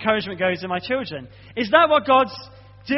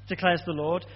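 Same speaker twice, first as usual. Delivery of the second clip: usual speaking voice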